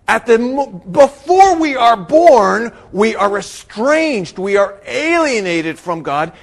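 loud, high preacher, stretching key words; full-force preaching. Real, not acted. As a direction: proclaim it to the whole congregation, loudly.

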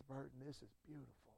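Southern country voice, near tears, very quiet; Southern preacher weeping softly in country accent; country accent crying. Retold or imitated. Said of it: "he's got the country accent".

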